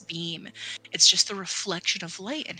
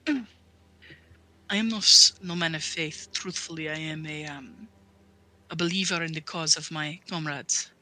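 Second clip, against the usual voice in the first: put-on subdued, halting confession in a foreign accent; humbled paladin, weighing each phrase; earnest accented paladin. Paladin confessing he is no man of faith, earnest and subdued. Godfried speaks of his faith.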